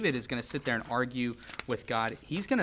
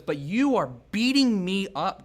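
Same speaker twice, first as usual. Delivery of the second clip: higher, louder, slow indignant protest at God; mock complaint; 6 semitones higher, 8 dB louder than usual. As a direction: act out a wounded accusation. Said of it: "And then he goes".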